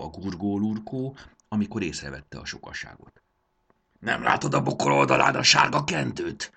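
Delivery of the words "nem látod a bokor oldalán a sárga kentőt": loud growling snap, pitched up; Triceps, growled retort. A narrator's reading. Triceps rebukes Barbó for missing the sign, growling. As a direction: growl it at him, loud and cross. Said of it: "mordult rá Triceps".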